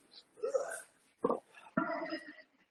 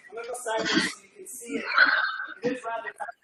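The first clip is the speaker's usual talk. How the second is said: strained laughing; 7 dB louder than usual. Real, not acted. Instructor straining during tricep push-up.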